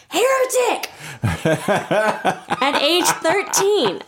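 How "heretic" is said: high furious cry of "Heretic!"; mock-outraged priest yelling heretic; angry priest shout. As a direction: bang the fist and shriek it high. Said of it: "slams his fist down on the pulpit".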